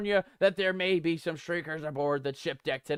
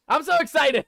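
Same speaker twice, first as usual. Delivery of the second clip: excited; 4 semitones higher, 6 dB louder than usual. Real, not acted. New update video starts.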